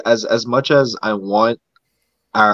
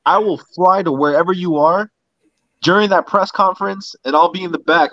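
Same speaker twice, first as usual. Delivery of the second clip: hyped up; 7 semitones higher than usual. Real, not acted. Hyped advice to friend.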